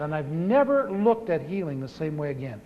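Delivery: ordinary talk, nothing marked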